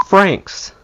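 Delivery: ordinary delivery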